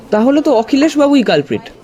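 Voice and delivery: high-pitched eager outburst; excited exclaim. A narrator's reading. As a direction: blurt it out, sure you've cracked it. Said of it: "আমি চেঁচিয়ে উঠলাম". (উত্তেজিত চিৎকার)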